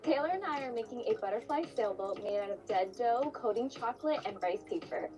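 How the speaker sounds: ordinary talk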